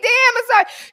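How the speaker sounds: high loud mock offense; a high, loud faked shock at her daughter; mock outrage. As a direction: yelp her name, pretend insulted.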